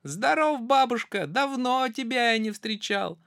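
high, loud friendly greeting; cheery mouse. Acted. Mouse greeting the owl, high and cheery. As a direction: hail granny owl.

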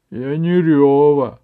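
loud, slow tearful denial; through tears. A narrator's reading. Grandmother denying she is a crybaby, through tears. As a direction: wail it in protest. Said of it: "сквозь слёзы ответила бабушка".

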